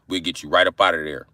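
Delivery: ordinary delivery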